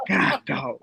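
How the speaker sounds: ordinary talk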